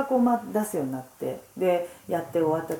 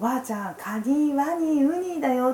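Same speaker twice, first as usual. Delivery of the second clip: a hint of a little boy coaching grandma; lightly voiced grandson; 8 semitones higher than usual. (孫の声を軽く真似て)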